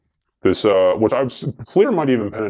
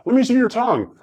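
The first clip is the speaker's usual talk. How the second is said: cop voice. Retold or imitated. Cop ordering, retold.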